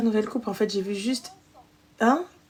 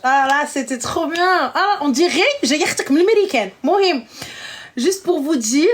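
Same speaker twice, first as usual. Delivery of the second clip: excited squeal; 5 semitones higher, 4 dB louder than usual. Real, not acted. Watching her old video, she squeals about her accent, excited.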